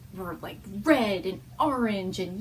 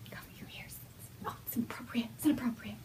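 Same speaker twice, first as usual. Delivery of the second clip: whisper; 2 semitones higher, 8 dB quieter, faster than usual. Real, not acted. Mother whispering to baby to cover his ears. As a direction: hush it to the little one.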